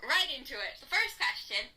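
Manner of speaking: shy and hesitant, held back; nervous old clip voice. Pretend. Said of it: "I can't even talk".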